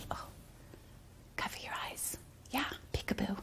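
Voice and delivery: hushed peekaboo whisper; playful whisper to a child. Acted. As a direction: whisper to hide the game. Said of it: "whisper game".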